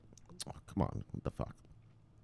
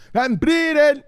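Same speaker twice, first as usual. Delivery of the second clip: high, drawn-out shout; hyped shout-out; 13 semitones higher, 10 dB louder, much slower than usual. Pretend. Hailing a viewer who joined the show, hyped shouting. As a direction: stretch the name into a gleeful holler.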